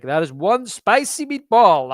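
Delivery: loud, drawn-out comic exclamation; hammed-up cartoonish reaction; quoted movie catchphrase. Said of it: "boom, he's like".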